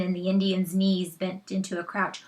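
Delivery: plain reading voice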